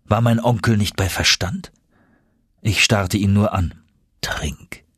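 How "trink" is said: low, soft, hushed prompt; gentle urging. A narrator's reading. Uncle offers his flask to nephew, gentle urging. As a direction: coax very quietly.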